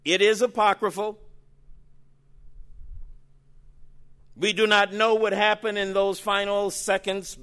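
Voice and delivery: pressing the point to the congregation; firm insistence. Real, not acted. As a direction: lean hard on is. Insists on the source's status.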